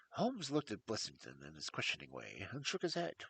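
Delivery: usual speaking voice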